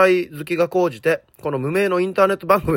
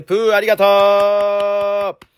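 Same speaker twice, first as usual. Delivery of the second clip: a slow, drawn-out, higher "プー、ありがとう"; playful thanks; 6 semitones higher, 4 dB louder, much slower than usual. Real, not acted. Host playfully thanking a listener after the letter. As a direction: toss off a goofy thank-you.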